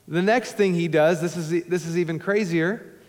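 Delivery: ordinary delivery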